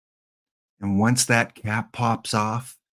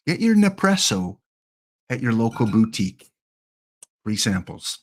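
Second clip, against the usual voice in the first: mock ad read. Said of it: "don't mind mispronouncing Nespresso".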